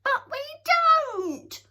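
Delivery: high, loud, slow shriek; shrill scream. A narrator's reading. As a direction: scream it out in protest. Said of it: "screamed Sniff shrilly".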